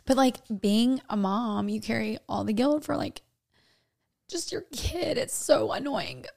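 soft, tear-choked words with the pitch cracking up; crying. Real, not acted. Crying to her co-host over guilt about her kid. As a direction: speak through real tears. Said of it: "I'm a really ugly crier".

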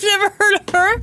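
mocking her curt brush-off; rude dismissive. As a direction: sneer it dismissively. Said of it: "instead you said".

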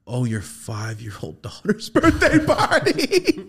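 slow, high question breaking into laughter; laughing incredulity. Retold or imitated. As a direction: tease a friend in disbelief, cracking up. Acted teasing reply, laughing.

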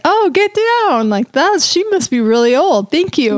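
high-pitched retold gasp of delight; mock flattered surprise. Retelling her reaction to the designer's compliment, mock surprised. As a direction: playfully act shocked at the compliment. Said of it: "And I was like".

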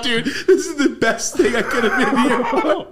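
delighted, cracking up; giddy laughing voice; laughing glee. Retold or imitated. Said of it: "I was like".

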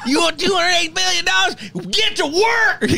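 mocking yell. Cohost yelling at a billionaire, laughing shout. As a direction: bark it out at him, half laughing.

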